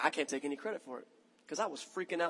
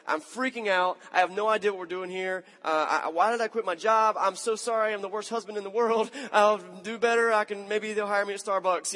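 higher, louder panicked texting voice; retold panic, pitched up and louder; 5 semitones higher, 6 dB louder than usual. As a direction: reread the frantic text in a rush of panic. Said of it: "And I was freaking out".

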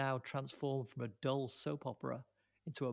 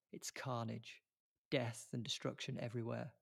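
ordinary talk

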